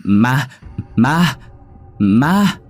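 high, loud shrieks of alarm; panicked scream. A narrator's reading. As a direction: cry out in sheer fright. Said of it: "thì la lên hoảng hốt".

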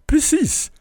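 beaming. A narrator's reading. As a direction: burst out beaming. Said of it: "strålade hon". (strålande glad, mycket ljusare)

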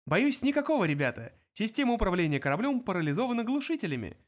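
high, perky computer voice; chirpy. A narrator's reading. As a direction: deliver bad news cheerfully. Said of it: "бодро объяснил компьютер".

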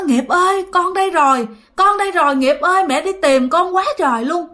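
high, tearful calling to her son; mother weeping over her chained son; crying mother. Retold or imitated. Mother finds son in hell, cries.

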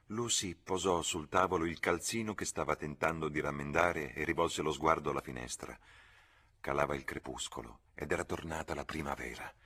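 usual speaking voice

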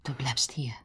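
firm hushed whisper; a voiceless order; whispering an order to his wife. Acted. Husband decides to act alone.

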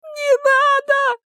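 very high, drawn-out wail. A narrator's reading. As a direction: burst into a piercing plea. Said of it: "вдруг заголосил Женя". (отчаянный тонкий вопль)

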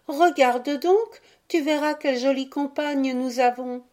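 put-on high, insistent child calling; pressing cry. A narrator's reading. Violette urging mother to see their companion, insistent cry. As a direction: urge mother to come and look. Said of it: "les cris pressants des deux marmots".

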